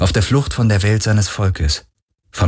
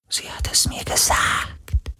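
flüsternd; 7 dB quieter than usual. A narrator's reading. Geist antwortet flüsternd.

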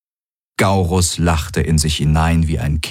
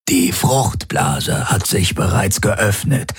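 calm; 2 semitones higher, slower than usual. Acted. Healer calmly informs birthing mother.

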